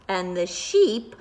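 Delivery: ordinary delivery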